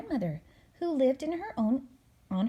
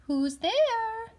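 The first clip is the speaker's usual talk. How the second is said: put-on much higher old granny call; grandmother's voice; 13 semitones higher, 4 dB louder than usual. Grandmother calling to the door in a high old voice. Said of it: "called a voice from inside".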